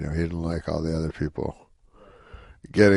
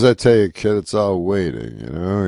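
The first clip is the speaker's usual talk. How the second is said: put-on louder Rodney "I tell you, kid"; Rodney Dangerfield impression; 7 dB louder than usual. Rodney alone with young Norm, louder weary confiding. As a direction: lean in like the old comic confiding. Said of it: "he goes".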